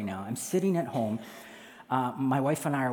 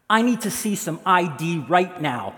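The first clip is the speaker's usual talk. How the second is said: loud, raised-pitch officer-like order; authoritative demand; 4 semitones higher, 6 dB louder than usual. Acted. Homeowner demanding ID from intruder, authoritative order. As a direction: order it firmly and loudly. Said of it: "this comes out of my mouth".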